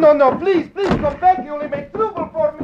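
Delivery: high, loud desperate begging; a scared immigrant begging the reporter to stay; frightened pleading. Acted. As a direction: beg him to come back, panicking. Frightened man begs Lowry to stay.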